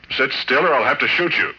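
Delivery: sharp loud threat to shoot. Acted. Man holding a gun on his captive, loud threat.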